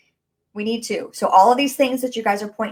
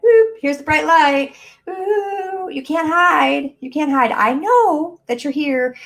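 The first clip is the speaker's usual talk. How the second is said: high, sing-out taunt, louder on the catch; teasing spotlight hunter; 7 semitones higher, 4 dB louder, slower than usual. Acted. Coach hunting down her hidden limiting belief, high and taunting. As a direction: flush out someone hiding, pitched well up.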